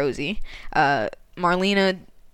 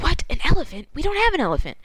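high-pitched incredulous retort; acted-out bewilderment; mock disbelief; 10 semitones higher, faster than usual. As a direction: play the baffled crew, pitch jumping up. Crew react to elephant news.

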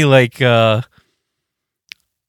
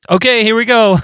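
a lightly voiced leader, higher and louder; breezy higher "here we go"; church band leader; 11 semitones higher, 5 dB louder than usual. Church band leader starting the song, higher breezy voice.

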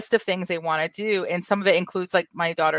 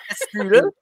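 put-on strudel in a hammy German accent; 4 semitones higher, 6 dB louder than usual. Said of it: "we are just killing the accents today".

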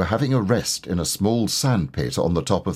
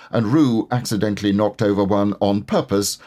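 plain reading voice

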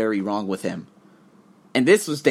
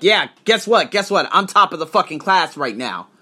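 brash boast, higher and louder; cocky bragging; 6 semitones higher, 6 dB louder than usual. Retold or imitated. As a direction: show off like the top of the class. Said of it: "bold and that fucking, ooh, uh, powerful Arsenio".

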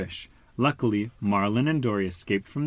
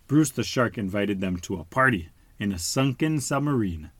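ordinary delivery, nothing marked

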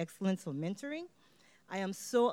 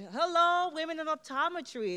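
a bright, much higher, louder hello; excited greeting; 9 semitones higher, 3 dB louder than usual. Real, not acted. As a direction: greet the crowd with a big lift. Greeting the audience with energy.

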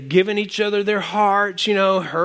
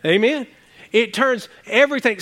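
usual speaking voice

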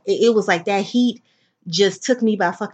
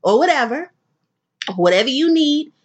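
imitating producer; 4 semitones higher, 3 dB louder than usual. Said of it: "So he was like".

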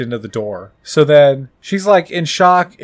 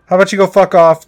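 fast, louder irate retort; mock-angry brush-off, quick and loud; 4 semitones higher, 5 dB louder, faster than usual.

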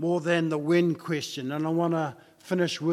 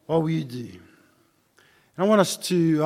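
usual speaking voice

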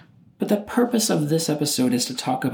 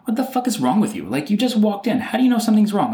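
higher, quick imitation of exasperated loved ones; retold irritated outburst, pitched up; mock annoyed; 8 semitones higher than usual. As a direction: snap at him as the fed-up friend. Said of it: "get so annoyed".

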